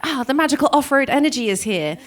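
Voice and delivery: high, magical-sounding mock wonder; playful elation. Rider retells hitting the off-road, playful elation. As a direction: come alive with sudden energy. Acting revival on reaching off-road.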